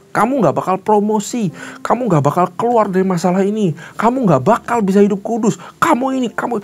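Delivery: the devil's high, mocking list of labels; taunting accuser.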